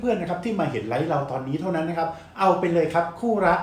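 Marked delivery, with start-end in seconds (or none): 1.6-3.5 loud, higher hype sales call; fast hype live seller. Acted. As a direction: build up to the sale price. Live sale price hype.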